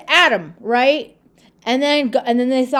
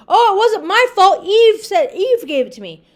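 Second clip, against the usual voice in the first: higher, louder mock-whiny Adam shifting blame; whiny excuse; 8 semitones higher, 5 dB louder than usual. Preacher mimics Adam blaming Eve, high whiny protest. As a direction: play Adam pleading it's not his fault. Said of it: "what did Adam say".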